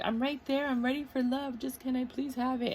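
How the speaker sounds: high-pitched eager plea; acted longing, voice lifted high; mock pleading. Voices the listener begging for love.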